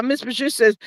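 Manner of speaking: ordinary talk, nothing marked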